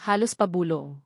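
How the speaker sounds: ordinary delivery, nothing marked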